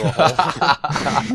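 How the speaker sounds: slow, laughing admission. Real, not acted. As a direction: laugh while owning the mistake. Admits a blunder, laughing.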